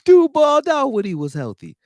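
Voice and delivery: mock outraged shout. Mocking a chat comment, laughing.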